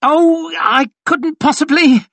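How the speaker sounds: very high, loud squeak; high-pitched, overdone protest. A narrator's reading. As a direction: squeak it out in mock modesty. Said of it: "he squeaked out".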